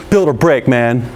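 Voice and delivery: low, smug scolding tone; judgmental. Shown as a demonstration. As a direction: say it like you're looking down on them. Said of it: "don't have to be judgmental like".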